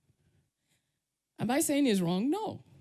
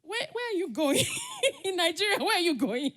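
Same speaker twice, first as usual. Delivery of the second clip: high, loud amused challenge; laughing disbelief; 9 semitones higher, 5 dB louder than usual. Real, not acted. Speaker mocking TV runaways, laughing incredulously. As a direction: ask it through laughter, incredulous.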